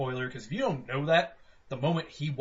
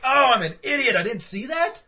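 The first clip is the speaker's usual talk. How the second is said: louder, higher stunned voice; stunned realization; 8 semitones higher, 4 dB louder than usual. Retold or imitated. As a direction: gasp it as the twist lands. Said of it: "where you're like".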